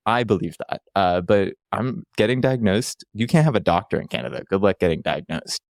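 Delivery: ordinary delivery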